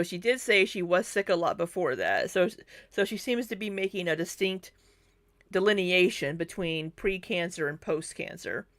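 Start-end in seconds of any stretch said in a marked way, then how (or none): none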